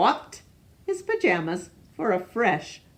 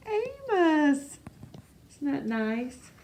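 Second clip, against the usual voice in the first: high playful; 6 semitones higher than usual. Acted. Reader asks children.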